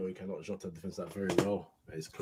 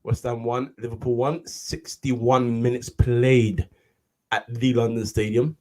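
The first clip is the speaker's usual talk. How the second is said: loud broadcast score read; commentary announcer voice; 3 semitones higher, 11 dB louder, slower than usual. Acted.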